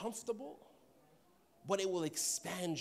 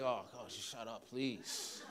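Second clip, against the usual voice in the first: soft, low exasperated groan; annoyed muttering; 7 semitones lower, 7 dB quieter, much slower than usual. Retold or imitated. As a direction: sigh it out, fed up. Imitating annoyed listeners.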